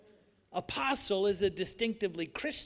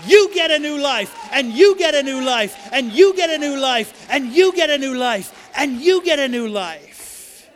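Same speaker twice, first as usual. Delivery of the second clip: high, much louder jackpot shout, each you thrown out; excited preaching shout; 6 semitones higher, 13 dB louder than usual. Acted. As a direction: hand out new life like prizes to the crowd. Preacher echoes the Oprah giveaway shout.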